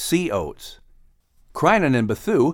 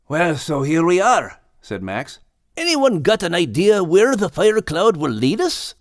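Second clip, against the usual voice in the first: lightly raised Scottish lad voice; earnest young Scot explaining; Max's voice; 6 semitones higher than usual. A narrator's reading. Max telling old friends about the reeds, lightly Scottish.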